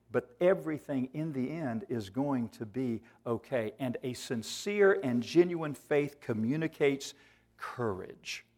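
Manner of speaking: ordinary delivery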